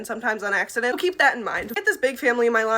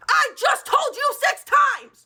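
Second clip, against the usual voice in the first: slow, high-pitched exasperated yell; hammed-up frustrated scream; exasperated shout; 5 dB louder, much slower than usual. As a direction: yell each word as if at your wits' end. Acted exasperation at repeated question.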